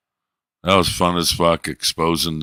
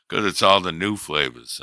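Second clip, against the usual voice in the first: ordinary talk, nothing marked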